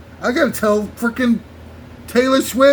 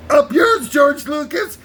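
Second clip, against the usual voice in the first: mock shouted refusal; 6 semitones higher, 3 dB louder, slower than usual. Chicago voiced refusing Lucas.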